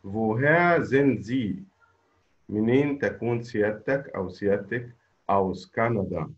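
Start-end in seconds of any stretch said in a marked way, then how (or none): 0.0-1.6 very slow and a bit loud; slow model answer. Shown as a demonstration. Teacher models the right answer for students, slow and clear. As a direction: stretch each word for the class to copy. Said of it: "الإجابة هي woher".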